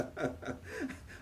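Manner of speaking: a light rising laugh at the joke; amused laugh. Acted. Uncle laughing at his friend's joke.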